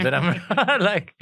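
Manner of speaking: slow, loud, laughing through words; words breaking into laughter. Real, not acted. Laughing at partner's impression.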